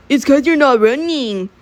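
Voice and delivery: mocking coach impression. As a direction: imitate a coach calling out slowly. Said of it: "coach was all".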